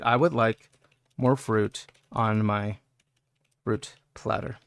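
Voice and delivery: low, slow seductive murmur; hammed-up seductive line; sultry and slow. Shown as a demonstration. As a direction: purr it like a sweet nothing. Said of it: "Let me set the mood".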